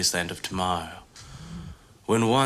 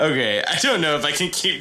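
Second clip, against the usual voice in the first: usual speaking voice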